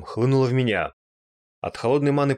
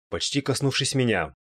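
ordinary delivery

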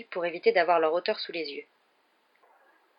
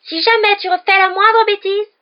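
very high, drawn-out mocking shriek; piercing yell; 14 semitones higher, 12 dB louder than usual. A narrator's reading. Rival imitating Weasley's mother, piercing yell. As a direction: screech it like a scolding mother. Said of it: "Il prit une voix perçante et hurla".

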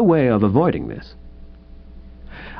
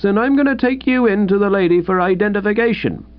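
higher, faster suspicious constable; gruff doubting London bobby, raised pitch; london policeman; 7 semitones higher than usual. Acted. Policeman voiced by reader.

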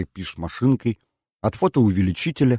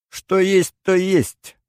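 much higher, teasing singsong; mocking singsong; 12 semitones higher, 5 dB louder, much slower than usual. A narrator's reading. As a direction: sing it out to tease her twin. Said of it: "пропела Сулима насмешливо". (насмешливо пропеть, гораздо выше)

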